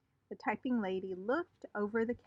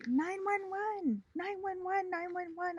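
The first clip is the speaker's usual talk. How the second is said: high, slow hollered alarm; a child hollering for help; 10 semitones higher than usual. Acted. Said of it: "I hollered".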